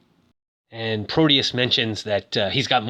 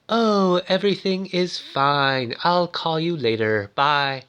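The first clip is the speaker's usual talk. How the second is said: stiff, higher-pitched first-generation machine voice; stiff computer double; 4 semitones higher than usual. Retold or imitated. Fake Susan sending Gabler away, stiff computer voice. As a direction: imitate a clumsy computer copy of a woman. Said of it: "it's really kind of, uh, stiff. Stiff and clumsy".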